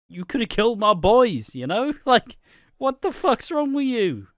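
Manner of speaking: high, loud, exasperated telling-off; mock furious rant. Ian and Cindy scolding Rocky for endangering their boys, high mock anger. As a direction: rip into him in a shrill mock fury.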